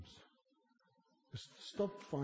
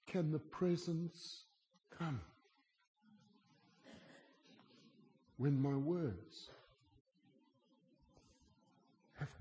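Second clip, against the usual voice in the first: slow pleading; 4 semitones higher than usual. Real, not acted. Slow pleading rhetorical question.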